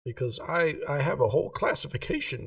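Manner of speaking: a hint of a prim gem-shop lady, keen on geometry. [name] offers to name stone.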